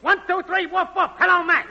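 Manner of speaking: high-pitched mic-check holler; woofing and yelling. Acted. Technician testing the network for Max, woofing and yelling. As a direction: yell out a test call to Max. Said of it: "woofing and yelling, "Hello, Max".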